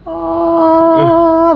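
long high "ohhh"; hammed-up moment of revelation; delighted realisation. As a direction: let out a high, loud mock-touched oh. Acts delighted realisation at request.

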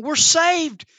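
thrilled stranded traveller; excited cry. Acted. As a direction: cry out like you've found rescue. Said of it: "someone's looking at it going".

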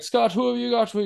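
high, sing-song tree hello; lightly hammed tree welcome; talking tree greeting. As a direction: greet the lost visitors brightly.

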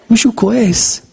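ordinary talk

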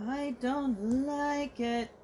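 slowly drawn out, uneasy. Real, not acted. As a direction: stretch it out in dread. Uneasy watching horror scene.

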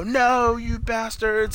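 high, drawn-out mock cry of outrage; hammed-up wail of horrified protest; retold anguished yell. Friend retelling Marty seeing Doc shot, mock anguished yell. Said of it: "you hear Marty go".

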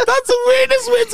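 words squeezed out through a laugh; laughing while talking. Real, not acted. Still laughing at the threat, words through the laughter. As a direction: speak high over the giggles.

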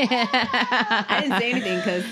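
loud, high laughing words. Real, not acted. Mom laughing with friends over her kid's joke. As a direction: talk through the laughter.

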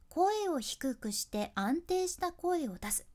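slow, even read of the tip; slow steady voice. Shown as a demonstration. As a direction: state it calmly, one word at a time. (「声を出す」まで落ち着いてゆっくり)

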